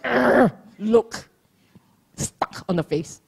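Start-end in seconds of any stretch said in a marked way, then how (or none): 0.0-0.5 loud sour grunt of disgust; grumpy urgh of annoyance. Retold or imitated. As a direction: make the sour face sound. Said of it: "that look".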